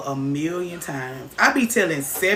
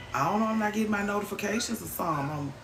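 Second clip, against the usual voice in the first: normal talking voice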